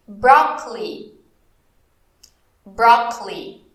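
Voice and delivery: slow English "broccoli", said "brokli" with a falling end; English pronunciation. Shown as a demonstration. Teacher models "broccoli" slowly in English. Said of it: "tenta focar na pronúncia em inglês".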